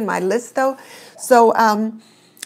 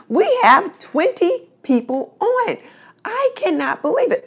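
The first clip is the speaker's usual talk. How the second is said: real delight at the crowd growing; excited; 9 semitones higher than usual. Real, not acted. Host thrilled at twenty viewers, excited high voice.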